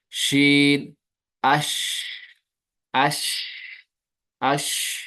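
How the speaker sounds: शीन and अश with the श spread through the mouth, slow; spread sh sound. Shown as a demonstration. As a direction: hold the hissing श of अश wide and long. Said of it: "तो इसकी आवाज फैल जाती है". (अश, फैली हुई श की आवाज़ के साथ)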